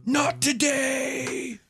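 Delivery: high, slow drawn-out taunt. Acted. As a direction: stretch each word out mockingly. GM voices Victoria's taunt.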